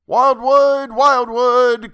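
high, loud station call. Acted. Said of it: "cried the trainman".

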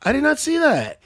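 loud, high-pitched amazement; pleasantly surprised. Real, not acted. Co-host reacting to a fight on the main card, real excited surprise. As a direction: marvel at it out loud, voice leaping up. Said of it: "I like when I get pleasantly surprised".

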